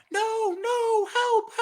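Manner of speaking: high, hammed-up cry for help; high panicky help; mock cry for help. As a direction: squeal for help like a fake victim. Mock struggle for sound effect.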